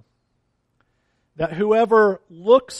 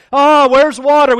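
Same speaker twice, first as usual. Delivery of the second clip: loud, high, complaining mock whine; preacher mocking the complaining Israelites, loud whine; whining Israelites; 5 semitones higher, 10 dB louder than usual. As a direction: whine like a grumbling crowd in the desert. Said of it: "they are complaining about everything".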